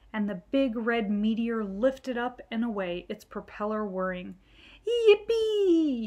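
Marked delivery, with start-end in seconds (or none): 4.9-6.1 high, loud cheer of delight; excited yell. Acted. Billy cheers as the plane takes off, high loud yell. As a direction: shout it with a little boy's glee. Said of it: "yelled Billy".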